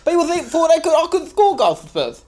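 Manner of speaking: high, boastful striker voice; Lucas Moura impression. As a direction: crow about scoring goals. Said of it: "It's me. Lucas Moura".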